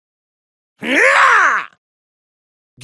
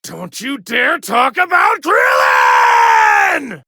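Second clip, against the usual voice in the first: angry shout; 6 semitones lower, slower than usual. Acted. Rage over fallen friend.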